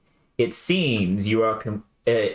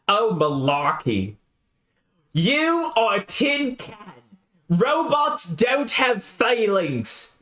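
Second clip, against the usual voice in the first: high, slow, scornful adventurer; scoffing Jake; 8 semitones higher, much slower than usual. Acted. Jake mocks the robot's feelings, high and slow. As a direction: drag it out, dismissing the robot.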